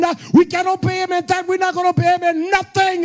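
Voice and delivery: rising to a high shout; building preaching. Acted. Preacher rebuking the congregation, building to a shout. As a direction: climb until you're crying out.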